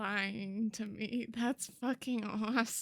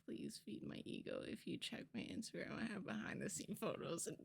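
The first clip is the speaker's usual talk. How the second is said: ordinary talk, nothing marked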